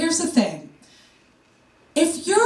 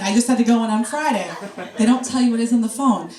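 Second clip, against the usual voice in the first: ordinary talk, nothing marked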